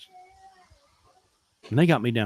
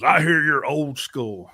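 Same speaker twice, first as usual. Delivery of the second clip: slow, drawn-out imitation of a manager; fleet manager voice; 3 semitones higher, 4 dB louder, slower than usual. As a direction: stretch it out as a mock boss greeting. Voices fleet manager calling him.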